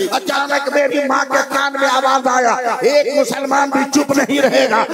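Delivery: fiery climax of a sermon; full-force preaching. Acted. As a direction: preach it out at the top of the lungs.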